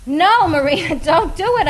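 high squeal of protest. A narrator's reading. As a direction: squeal it, half laughing. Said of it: "I'd squeal".

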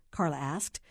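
plain reading voice

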